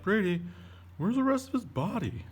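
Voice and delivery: high, loud imitation of a worried pupil; mock alarmed pupil. Pupil questioning teacher about the shark's missing body, mock alarm. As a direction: squeal it like a baffled young fan. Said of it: "And you're like".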